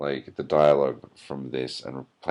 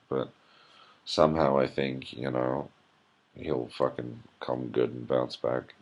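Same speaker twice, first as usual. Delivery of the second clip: normal talking voice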